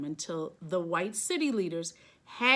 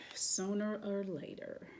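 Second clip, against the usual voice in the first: fading echo; 9 dB quieter than usual. A narrator's reading. Refrain echoed, fading out.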